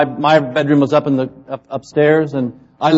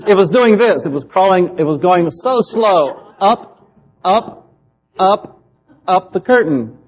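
suspense; 4 semitones higher, slower than usual. Retold or imitated. Child watching a bat crawl.

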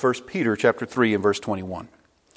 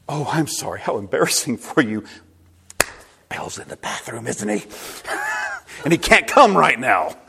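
mock sympathy that breaks into laughter. Elijah to the prophets of Baal on Mount Carmel, mocking. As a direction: taunt with fake concern, laughing toward the end. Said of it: "Elijah started mocking the prophets".

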